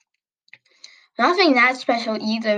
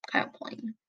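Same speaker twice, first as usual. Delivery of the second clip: whispered aside; 12 dB quieter than usual. Real, not acted.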